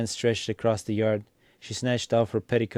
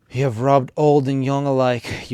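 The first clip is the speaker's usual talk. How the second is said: louder furious bawl, pitched up; a much louder outburst at the in-laws; 4 semitones higher, 8 dB louder than usual. A narrator's reading. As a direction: hurl it at the whole family as a shriek of accusation. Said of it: "Aksinya went on bawling".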